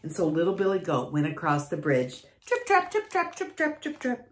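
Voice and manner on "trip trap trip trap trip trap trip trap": light high footsteps. A narrator's reading. Little goat's footsteps.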